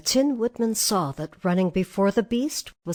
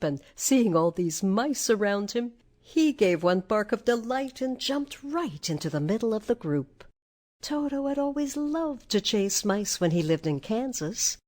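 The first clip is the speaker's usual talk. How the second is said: ordinary delivery, nothing marked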